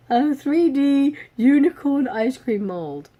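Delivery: slow, loud, high and amused; grand product announcement. Acted. Vlogger proclaims the mold after laughing, grandly announced. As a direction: proclaim it with drawn-out relish.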